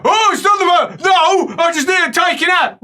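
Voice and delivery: high, loud protest; acted indignant yell. Patient defending himself to helper, acted indignant shout. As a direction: argue back in a fluster.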